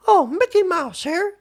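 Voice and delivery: very high squeaky cartoon mouse voice; amateur cartoon mascot falsetto; Mickey Mouse. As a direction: put on a high-pitched falsetto mouse impression. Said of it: "the famous Mickey Mouse voice".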